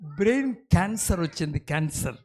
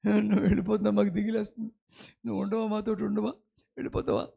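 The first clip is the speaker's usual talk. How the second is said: softer, quickening plea fading to a whisper; frightened disciples; 3 semitones higher, 4 dB quieter, much faster than usual. Retold or imitated. Disciples panicking as Jesus leaves, fading to a whisper. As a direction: beg fast and fade out in worry. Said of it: "శిష్యులు బెంబేలు ఎత్తిపోతున్నారు".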